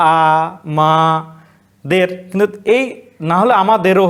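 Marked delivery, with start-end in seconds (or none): 0.1-2.1 আ, মা, দের split into single syllables with long pauses; slow syllable count. Shown as a demonstration. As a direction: break আমাদের into its syllables, stopping on each. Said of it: "আমি আরেকটু বলতে পারি".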